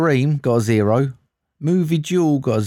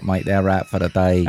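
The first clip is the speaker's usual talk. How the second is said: usual speaking voice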